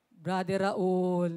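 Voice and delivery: slow, booming voice calling a name, only lightly put on; a hint of the voice of God; God's voice. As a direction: call out the name like a voice from heaven. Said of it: "Ganun ba yung boses?".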